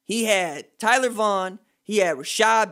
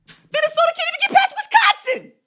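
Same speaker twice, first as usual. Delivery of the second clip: much higher and louder, sneering sing-song; mocking disbelief; 5 dB louder than usual. Real, not acted. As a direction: ridicule them with an exaggerated squeal. Host ridicules Minnesota.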